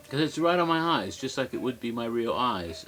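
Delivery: ordinary talk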